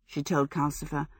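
plain reading voice

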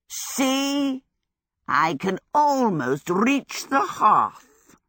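slow, swooping boast, starting high; proud fire demon. A narrator's reading. Calcifer showing Sophie his reach, proudly straining. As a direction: show off with drawn-out effort. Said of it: "he said proudly".

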